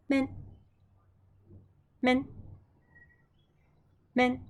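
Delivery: Men, said short and clear, three times over; Greek word repeated. Shown as a demonstration. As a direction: model Men for the class to repeat. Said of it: "easier to say".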